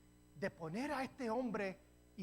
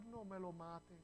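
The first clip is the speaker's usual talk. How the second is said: begging; 9 dB quieter than usual. Retold or imitated. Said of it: "le suplicó".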